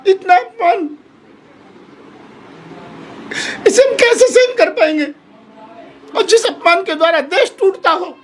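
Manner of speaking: real crying, voice pitched high; tearful. Real, not acted. Guest saint laments the insult to the Prophet, in tears. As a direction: choke it out through sobs, pausing between phrases. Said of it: "क्या आप जैसे और भी संत रोए होंगे".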